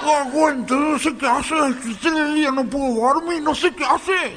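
desperate high whine, mouth swollen; hammed-up toothache agony; dolor de muela. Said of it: "parecía que traía una bocha dentro de la boca". (desesperado, agudo, con la boca hinchada)

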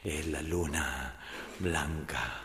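slow, low, soft confiding; hushed confession. Acted. Woman confessing her night to the priest, hushed and slow. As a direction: linger softly over each romantic detail.

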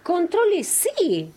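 slow, high-pitched scolding; reprimenda. Real, not acted. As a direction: drag out the order to calm down. Reprende al colega que grita. (reprimenda lenta y aguda)

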